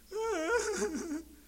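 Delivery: high, hammed-up fake sob; mock wailing cry. Preacher mocking a diner crying over steak, high fake wail.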